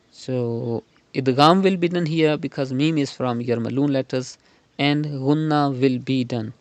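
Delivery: ordinary delivery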